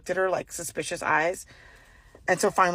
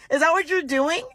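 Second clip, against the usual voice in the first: sassy challenge; 9 semitones higher than usual. Retold or imitated. Confronting the nosy shopper.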